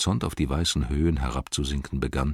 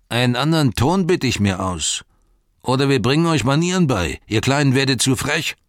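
threatening; 9 semitones higher, 4 dB louder than usual. A narrator's reading. Hobbit threatens the ruffian.

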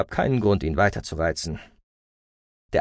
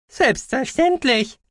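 casual; 4 dB louder, slower than usual. A narrator's reading. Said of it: "sagte Gucki lässig".

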